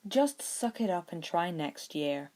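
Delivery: plain reading voice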